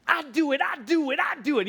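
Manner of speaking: put-on high, insistent three-year-old voice; imitating a toddler. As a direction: play the stubborn little kid saying it over and over. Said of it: "he'd be like".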